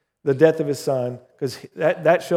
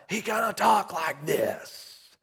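mock strained half-whisper, slow and a little higher; whispering evangelist; 5 semitones higher, 7 dB quieter, slower than usual. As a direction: imitate the pastor with clipped vocal cords. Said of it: "They called him the whispering evangelist".

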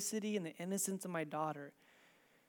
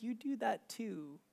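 soft, slow, tender rebuke; gentle; 2 semitones higher, 5 dB quieter, slower than usual. Retold or imitated. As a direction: correct them gently, with the voice dropped. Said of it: "speaks to me just so gently".

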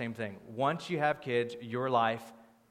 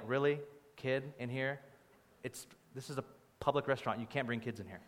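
low, hushed imitation of judgy onlookers; mock disapproval; 5 semitones lower, 9 dB quieter than usual. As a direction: quietly mimic a scandalized diner, voice dropping. Onlookers disapproving of a crying child.

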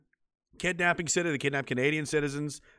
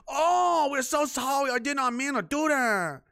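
high, slow whiny fake apology; hammed-up sorry voice; mock apology; 10 semitones higher, slower than usual. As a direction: play the groveling culprit, hammed-up.

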